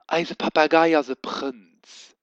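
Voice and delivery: lightly voiced grand lady, drawn out; proud lady. A narrator's reading. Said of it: "seht Madame Feierstein".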